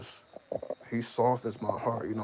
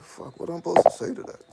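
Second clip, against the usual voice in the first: stunned reply; 9 semitones higher, 13 dB louder than usual. Retold or imitated. Dad stunned by son's advice.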